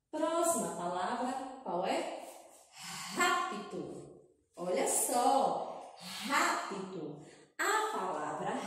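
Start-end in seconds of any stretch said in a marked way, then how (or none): none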